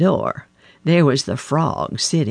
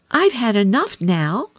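higher, contented frog; satisfied frog; 7 semitones higher than usual. A narrator's reading. Frog after feasting, contented higher voice. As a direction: sigh that you're full. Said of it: "said the frog".

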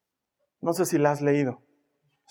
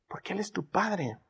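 ordinary delivery

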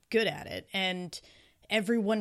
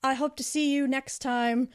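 put-on high, sweet elderly lady praising a player; gently voiced old woman, a touch higher; lightly voiced kindly old reader; 6 semitones higher, 3 dB louder than usual. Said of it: "she comes by and she's like".

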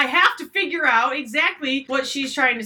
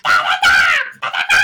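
put-on high shrieked baby babble, much louder; toddler shouting; 9 dB louder than usual. Imitating her toddler yelling from the back seat, shrieked. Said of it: "she's like".

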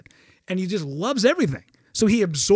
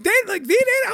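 lightly voiced stunned brother-in-law, higher; a hint of amazed disbelief. Brother-in-law hearing the news, mildly amazed. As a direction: ask it like you can't believe it.

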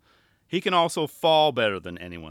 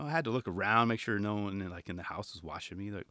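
ordinary talk, nothing marked

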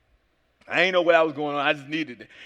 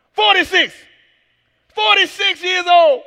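slow, loud emphatic cry; loud disbelief; 8 semitones higher, 9 dB louder, slower than usual. Real, not acted. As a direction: shout the age out slowly. Stressing his age in disbelief.

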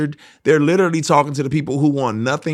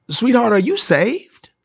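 sweet church lady; 9 semitones higher than usual. Retold or imitated. Church lady greets a stranger, high and sugary. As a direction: play the sweet lady asking gently. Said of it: "this woman comes up to me and she says".

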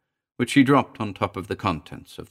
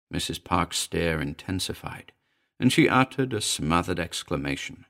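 plain reading voice

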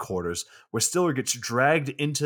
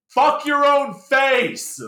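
screamed much higher and louder, drawn out slowly; furious scream; 7 dB louder, much slower than usual. Retold or imitated. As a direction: yell it down the phone with full rage. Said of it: "and then yells to them".

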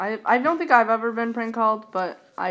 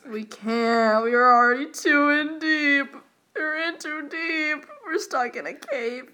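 higher, slow, dramatic lament; mock dramatic; 7 semitones higher, slower than usual. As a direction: ham up being trapped. Dramatic stuck-in-a-cave bit.

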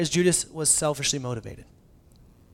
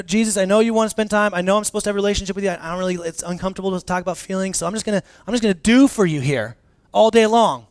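quick, loud, higher-pitched excuses; busy believer's excuse; 5 semitones higher, 7 dB louder, faster than usual. Pretend. Churchgoer making excuses to Jesus, hurried and loud. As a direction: rattle off the excuse to dodge time with Jesus. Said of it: "like, I know".

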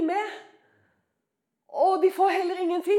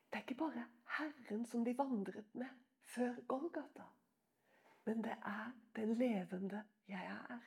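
lower, very soft, near whisper; hushed awe; 7 semitones lower, 19 dB quieter than usual. Real, not acted. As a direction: let the awe sink the voice to a breath. Awed recognition of the Lord. (dempet ærefrykt)

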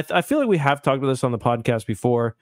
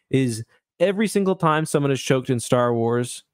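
normal talking voice

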